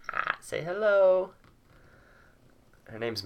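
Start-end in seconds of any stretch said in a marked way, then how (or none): 0.1-1.3 very high, loud, stretched-out coo; pet talk. Real, not acted. Coaxing the cat to greet viewers, high pet-talk squeal.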